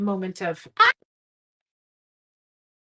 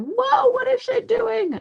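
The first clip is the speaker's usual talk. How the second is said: much higher, slow, louder mock "whoa"; imitating baffled viewers; 8 dB louder, slower than usual. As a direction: play a confused viewer, stretched in high falsetto. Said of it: "You're probably going".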